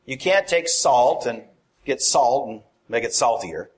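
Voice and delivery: normal talking voice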